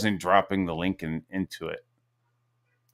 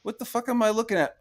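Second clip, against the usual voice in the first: mock disbelief; 13 semitones higher, faster than usual. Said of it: "I was like".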